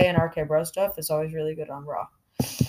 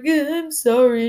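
high, slow mock-tearful whine; hammed-up tearful apology; fake crying; 8 semitones higher, 5 dB louder than usual. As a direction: imitate a put-on sob, louder and drawn out. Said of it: "And she started crying".

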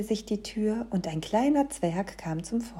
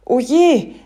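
loud, raised oh dear; startled dismay; 5 semitones higher, 11 dB louder than usual. A narrator's reading. Santa looks at his watch, loudly startled. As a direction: exclaim loudly in sudden alarm. Santa notices the time and stops.

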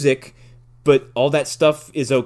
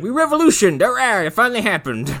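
mock cheer; 9 semitones higher than usual. Bartender celebrating the revolution, hammed-up high cheer. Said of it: "Woody Harrelson's like".

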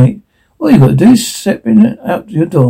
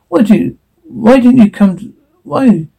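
high, halting, broken-off question; distressed plea; 7 semitones higher than usual. Acted. Character calls out for Larry, distressed plea. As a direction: plead upward, restarting as words fail.

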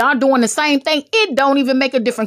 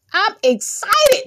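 high, drawn-out excited squeal; 12 semitones higher than usual. Shown as a demonstration. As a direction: stretch it out high with playful excitement. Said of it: "This is my excited voice".

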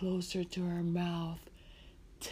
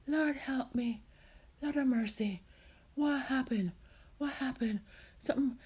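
higher-pitched, faltering plea; mild distress; 6 semitones higher than usual. Acted. Marcia rubbing her head before fainting, mildly distressed. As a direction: appeal for help, bewildered.

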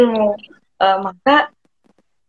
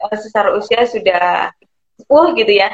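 ordinary talk

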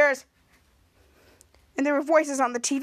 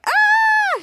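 loud, very high mock scream; imitated scream; 7 dB louder than usual. Muchacho getting hugged, high acted scream. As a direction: shriek it in pretend horror. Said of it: "And he screams like".

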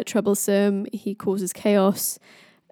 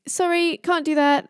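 much higher-pitched mock apology; high, offhand character voice; Loki; 8 semitones higher than usual. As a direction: play an unbothered trickster refusing, pitched high. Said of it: "Loki's like".

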